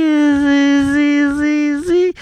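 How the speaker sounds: high, loud stammering voice; nervous guest begging to get in; squeaky nervous visitor. Acted. Visitor pleading at the secret door, high and loud.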